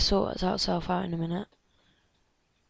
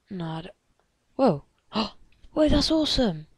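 usual speaking voice

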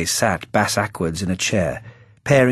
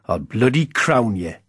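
northern threat; 2 semitones higher than usual. A narrator's reading. Whitley threatens the youth.

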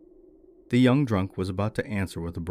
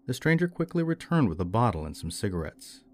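plain reading voice

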